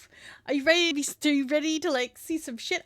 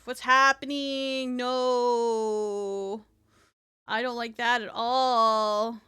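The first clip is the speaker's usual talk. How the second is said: loud, slow, drawn-out protest; dismayed wail; 7 dB louder, much slower than usual. Real, not acted. Streamer watching the screen do weird stuff, dismayed wail. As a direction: stretch the vowels in dismay.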